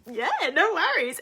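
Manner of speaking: high, slow, sing-out agreement; mock-sweet reply. Waitress rewarding the corrected customer, mock-sweet. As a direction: stretch it out high with exaggerated obligingness.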